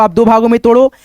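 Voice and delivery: ordinary talk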